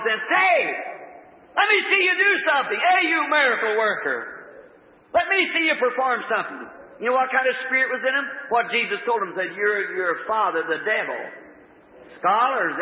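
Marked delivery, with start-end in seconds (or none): 0.3-6.7 high, jeering hail; mocking. Crowd mocking the miracle worker on his road, jeering. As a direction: taunt him with a raised voice. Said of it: "And the others mocking".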